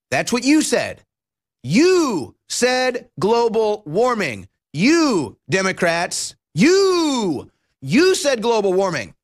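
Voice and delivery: YOU hit hard, slow and accusing; stress on YOU. Acted. Host accusing Democrats over the term, YOU stressed. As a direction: jab the word "you" at them, slow.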